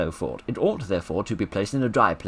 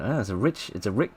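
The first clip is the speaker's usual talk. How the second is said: ordinary talk, nothing marked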